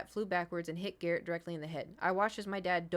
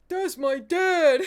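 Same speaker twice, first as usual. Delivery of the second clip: loud, drawn-out proud cry of recognition; excited shout; 10 semitones higher, 14 dB louder, much slower than usual. Retold or imitated. Recalling watching Superman, she cries out loud and slow in delight. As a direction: shout it like spotting your hero. Seeing Superman on screen, exclaiming.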